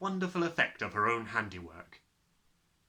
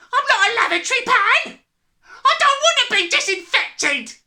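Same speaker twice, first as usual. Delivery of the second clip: high, panicked screaming protest; crying out; 16 dB louder than usual. A narrator's reading. Husband refusing to be disinfected, crying out. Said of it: "the husband cried".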